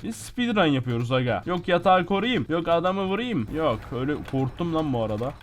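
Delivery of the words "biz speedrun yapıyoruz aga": usual speaking voice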